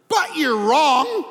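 loud and slow; sudden loud you're wrong; blunt punchline. Retold or imitated. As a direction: land the verdict hard. Punchline to friends.

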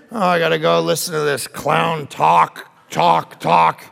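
low, drooping grumble; mock the weary introvert groaning; grumbling dread.

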